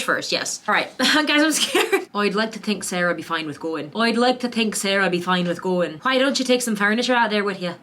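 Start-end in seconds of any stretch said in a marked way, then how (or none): 2.1-3.9 first-try Western Irish accent, lightly done. Shown as a demonstration. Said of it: "these are our practice sentences". 4.0-6.0 second take of the line in Western Irish, a touch more sure; Western Irish accent. Shown as a demonstration. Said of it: "that's my Western Irish". 6.0-7.7 a light Western Irish accent on a practice question. Shown as a demonstration. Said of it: "that's my Western Irish".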